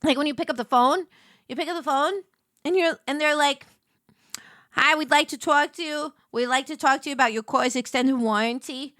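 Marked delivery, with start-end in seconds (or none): none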